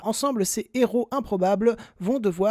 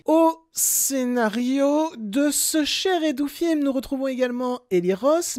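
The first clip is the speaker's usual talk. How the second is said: sarcastic; 5 semitones higher, 3 dB louder than usual. Real, not acted. Ironic praise of bad film.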